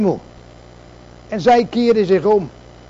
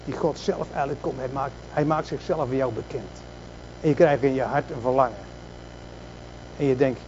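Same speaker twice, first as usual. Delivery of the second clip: much lower and softer, drawn inward; hushed preaching; 7 semitones lower, 9 dB quieter than usual. Acted. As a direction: pull the congregation close with a low hush. Preacher drops to intimate hush.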